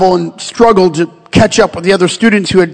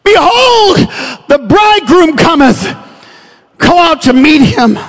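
heralding cry; 6 semitones higher than usual. Acted. Preacher voices the midnight cry for the bridegroom, heralding cry. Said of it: "there was a cry made".

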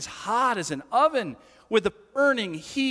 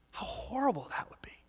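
hushed whisper; 3 semitones higher, 10 dB quieter than usual. Real, not acted. Preacher pictures teenage girls weeping silently, hushed whisper. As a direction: whisper it, much softer.

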